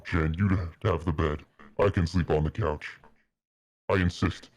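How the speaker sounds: ordinary delivery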